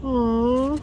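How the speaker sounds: usual speaking voice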